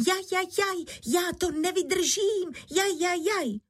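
high-pitched wailing yelp of pain; křik bolesti. A narrator's reading. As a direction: wail as if your head is burning. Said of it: "že se dala do křiku". (vysoký bolestný nářek)

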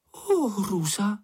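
high, slow storybook cry of dismay; mild mock horror; frightened cry. A narrator's reading. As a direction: gasp it out in horror. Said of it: "vykřikla".

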